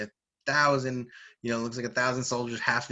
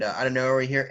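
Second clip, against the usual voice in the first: usual speaking voice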